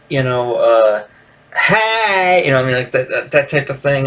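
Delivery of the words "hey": put-on high, drawn-out party girl "hey"; mock overprivileged party girl. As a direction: squeal a greeting like a party girl. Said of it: "party girl voice".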